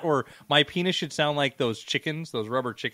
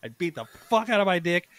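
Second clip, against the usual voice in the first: usual speaking voice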